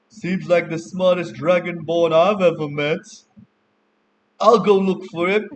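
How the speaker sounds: high, loud tavern-goer voice; tavern patron out loud. Acted. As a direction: pipe up mockingly for the whole table to hear. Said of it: "one of them will say out loud".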